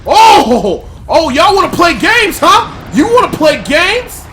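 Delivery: shouted high and loud at the enemies; furious shout. Real, not acted. Gamer yelling at ambushing game enemies, furious shout. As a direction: yell it like you're ready to brawl.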